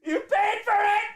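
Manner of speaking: high, loud laughing taunt; hammed-up shouted tease; mock stripper shout. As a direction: yell it at the audience as a mock stripper.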